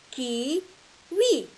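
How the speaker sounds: slow "au début du mot kiwi", the K set apart; slow modelling. Shown as a demonstration. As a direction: stretch it out for young pupils. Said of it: "models word slowly".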